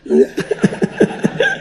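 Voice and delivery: a high airy burst of laughter; high breathy laugh. Real, not acted. Preacher laughs at the farmer's boast, high and airy. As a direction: let out a high unvoiced laugh.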